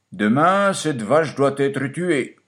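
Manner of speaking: the farmer announces the cow's fate, slow and high; master's decree. Acted. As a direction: pronounce the verdict slowly as the farmer.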